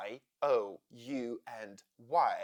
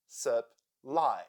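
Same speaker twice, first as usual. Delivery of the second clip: syllable by syllable; 3 semitones lower than usual. Shown as a demonstration. Teacher showing the two syllables of Supply, syllable by syllable. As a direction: Supply split in two, with a pause between syllables. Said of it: "Here we have two syllables".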